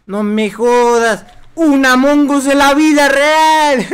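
slow, loud, drawn-out disbelief; amazed disbelief. Real, not acted. Streamer seeing an Among Us creature, amazed. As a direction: stretch it out in amazement.

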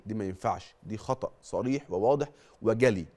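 usual speaking voice

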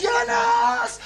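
high, slow drawn-out shout; mock yell. Son imitating father calling for help, mock drawn yell. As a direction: stretch the cry out. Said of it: "وبعدين قلت".

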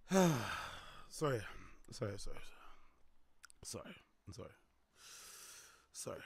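sheepish quiet apology. Real, not acted.

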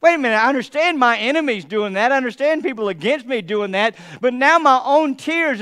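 ordinary talk, nothing marked

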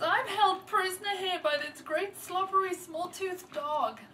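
high, loud wail of protest; storyteller voicing an upset, lonely girl; lonely girl's lament. A narrator's reading. Lonely girl crying out her complaint.

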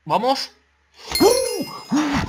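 high, loud triumphant whoops; victory shout. Real, not acted. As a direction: roar with joy at the win. Winning the match.

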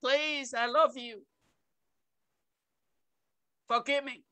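low, soft plea to God; humble, softened entreaty; pleading prayer. Retold or imitated. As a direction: drop to a tender, hushed appeal. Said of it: "to come back and say".

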